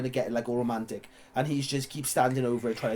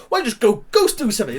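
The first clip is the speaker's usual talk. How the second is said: higher, louder mock brush-off; annoyed dismissal; 8 semitones higher, 6 dB louder than usual. Friends tell the weird guy to leave, mock annoyed. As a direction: shoo the weird guy away. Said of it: "they're just like".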